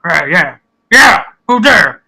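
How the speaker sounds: fumbling oblivious brute; a dim ogre caught off guard. Acted. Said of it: "He goes".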